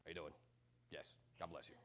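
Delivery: low, soft, lightly robotic church greeting; a hint of a programmed robot; robotic greeter. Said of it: "seems like they're programmed".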